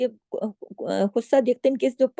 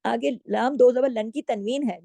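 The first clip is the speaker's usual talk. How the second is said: normal talking voice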